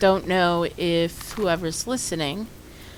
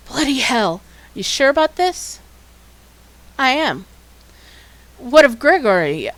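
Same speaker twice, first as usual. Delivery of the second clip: higher, louder character reading; dialogue reading; 5 semitones higher, 4 dB louder than usual. Acted. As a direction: voice the characters with energy. Voices game dialogue aloud.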